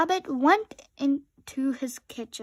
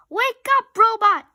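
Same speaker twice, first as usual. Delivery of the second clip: yelling; 10 semitones higher, 11 dB louder than usual. A narrator's reading. Rabbit yelling to wake Robot.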